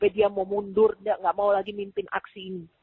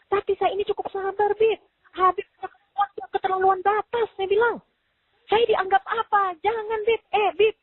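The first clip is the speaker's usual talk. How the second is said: worked-up outburst; 12 semitones higher than usual. Retold or imitated.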